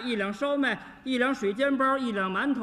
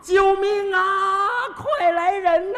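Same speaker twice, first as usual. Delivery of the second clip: hammed-up shout, louder and higher, slow; cry for help; 6 semitones higher, 5 dB louder, slower than usual. Girl clinging to the man, shouting loud and high for help. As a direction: wail for help like a panicked girl. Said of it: "一边抱一边就喊呐".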